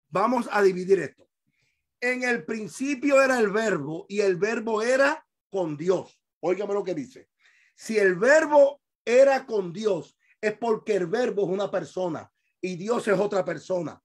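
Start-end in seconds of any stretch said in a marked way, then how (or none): none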